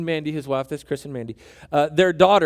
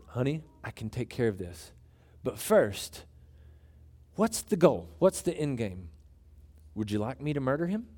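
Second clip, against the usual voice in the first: much softer and lower, coolly calm; quiet, unbothered husband; 4 semitones lower, 9 dB quieter than usual. Retold or imitated. Husband calmly asking his wife what she wants, soft and low. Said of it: "He was so calm".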